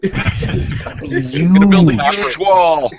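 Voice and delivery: slow, loud, high mock Trump through laughter; hammed-up Trump boast; laughing Trump voice.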